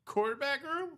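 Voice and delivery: incredulous high echo; surprised. Real, not acted. Incredulous echo of quarterback room.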